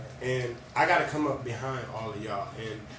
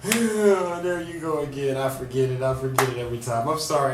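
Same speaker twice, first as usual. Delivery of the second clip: emotional, close to tears; blubbering; 3 semitones higher, 7 dB louder than usual. Real, not acted. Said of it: "I'm so emotional right now".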